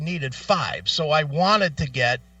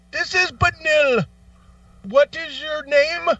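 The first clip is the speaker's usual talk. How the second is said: mock phone agent; 12 semitones higher than usual. Second agent picking up the call, high sing-song mock greeting. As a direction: announce yourself like a helpline rep.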